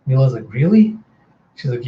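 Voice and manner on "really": high, rising disbelief; startled, rising query; surprised question. Retold or imitated. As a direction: ask it loudly in surprise. Girl reacts to the news.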